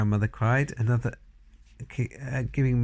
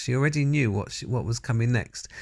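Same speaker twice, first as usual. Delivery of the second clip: plain reading voice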